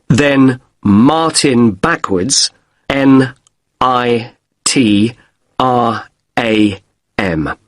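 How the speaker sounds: slow, spelt out letter by letter. Acted. Guest repeats his email for the receptionist, slowly. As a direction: say each letter separately, with pauses. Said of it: "A bit slower".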